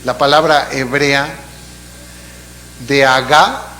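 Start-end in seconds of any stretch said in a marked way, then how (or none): 2.9-3.7 agá with the final A stressed, a bit louder; stress on the last A. Shown as a demonstration. Pastor teaching the Hebrew word, stress on the final A. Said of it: "con acento en la última A".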